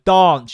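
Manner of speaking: loud "Dance" with a broad AH; emphatic long-A dance. Retold or imitated. Parent correcting the child, loud long-AH "Dance". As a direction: punch "Dance" out as DAHNCE. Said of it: "And I'm going".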